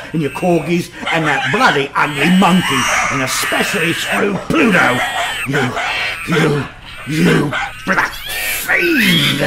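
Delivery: rising into a high, loud roar on fiend; husband screams at the cat before attacking it, furious shout. Acted. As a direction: spit the words at the cat, building to a howl.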